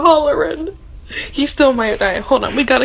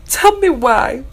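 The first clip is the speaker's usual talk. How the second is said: normal talking voice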